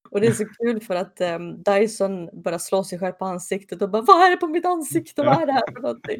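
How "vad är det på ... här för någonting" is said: high, loud panicky yelp; mock panic. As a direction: freak out at your own face. Dyson slapping his face. (gäll, panikslagen röst)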